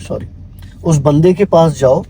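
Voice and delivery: ordinary delivery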